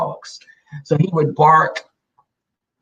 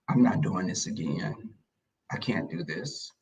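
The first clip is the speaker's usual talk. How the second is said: low, soft and worn out; groggy, defeated murmur to herself; weary mutter; 9 semitones lower, 12 dB quieter than usual. Retold or imitated. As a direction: let it sag like you've given up at night. Worn out by the puppy at night.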